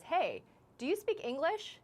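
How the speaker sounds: high, slow imitation of an intrusive stranger; confronting stranger. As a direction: imitate a stranger's pointed question, drawn out and high. Stranger confronts her, retold.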